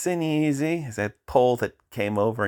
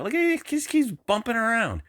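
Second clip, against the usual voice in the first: incredulous; 12 semitones higher than usual. Real, not acted. Watching drone bounce.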